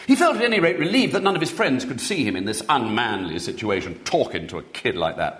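ordinary delivery

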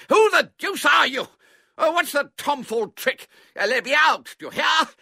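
high, loud outraged shouting; angry demand. A narrator's reading. Man locked in shed to his captor, shouting angrily. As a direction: yell to be released.

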